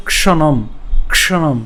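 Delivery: "ক্ষণম" with a distinct k-sh cluster at the start; careful pronunciation. Shown as a demonstration. Teacher modelling the hard cluster, careful k-sh in "ক্ষণম". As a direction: say "ক্ষণম" carefully, both consonants of ক্ষ heard. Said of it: "এটা আমরা উচ্চারণ করবো হচ্ছে".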